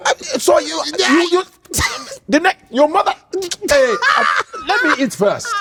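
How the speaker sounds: high, broken mock fury; a hammed-up, sputtering angry father; mad father sputtering. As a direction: sputter half sentences high, too mad to finish. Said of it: "he's like this".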